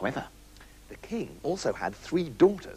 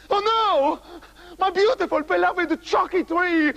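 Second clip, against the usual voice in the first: howling king; 10 semitones higher than usual. Acted. King howling over his withered chocolate tree, wailing. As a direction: wail it like a heartbroken king. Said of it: "howled the king".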